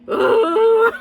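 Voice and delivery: very high, loud squealed "ooh"; exclamatory ooh. Real, not acted. Reviewer overjoyed by the movie, squealed ooh. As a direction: squeal it out, breaking into laughter. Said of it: "a lot of exclamatory sounds".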